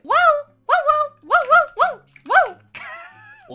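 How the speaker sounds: high, loud imitated woofs; dog barking. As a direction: bark insistently like a dog.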